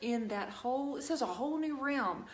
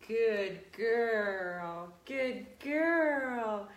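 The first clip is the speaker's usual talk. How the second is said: drawn-out praise for a dog; talking to a dog; 3 dB louder, much slower than usual. Real, not acted.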